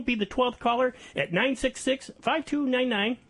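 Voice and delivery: ordinary delivery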